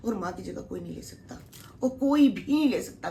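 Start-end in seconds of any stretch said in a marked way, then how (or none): 1.8-3.1 emotional outburst. Real, not acted. Insisting nobody replaces mother.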